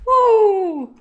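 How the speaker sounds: loud, high whoop; excited cheer. Real, not acted. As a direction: let out a big happy whoop. Sudden cheer during the fight.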